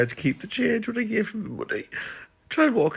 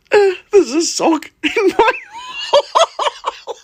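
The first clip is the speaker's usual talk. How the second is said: higher, louder, breaking into laughter; laughing shout; 5 dB louder, slower than usual. Shown as a demonstration. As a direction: shout the mixed-up line through laughter. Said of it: "I muddled my words and said".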